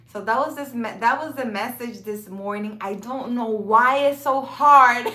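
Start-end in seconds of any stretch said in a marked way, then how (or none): 2.8-5.1 louder, effortful delivery, as if speaking is hard; forced speech. Real, not acted. Vlogger struggling to speak to viewers, forcing the words out. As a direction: push each word out with effort. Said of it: "like forcing myself".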